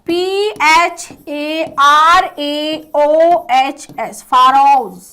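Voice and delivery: very slow, letter by letter; spelling out. Shown as a demonstration. Teacher correcting the spelling of pharaohs, slow spelling out. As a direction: spell it out slowly and firmly for learners.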